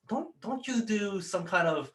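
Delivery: high-pitched, tentative recognition; curious organizer. Retold or imitated. Soup kitchen organizer questioning a volunteer, high curious voice.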